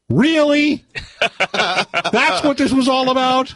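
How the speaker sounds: high-pitched, drawn-out disbelief; mock outrage at a letdown; incredulous. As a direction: squeal it as outraged disbelief, half laughing. Retells his own disbelieving reaction.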